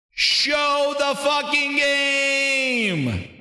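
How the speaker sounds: high, long drawn-out wail of frustration; slow, high exasperated holler; exasperated outburst. Real, not acted. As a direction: drag every word out high, utterly fed up. Fed up with the presentation.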